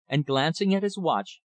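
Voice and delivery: plain reading voice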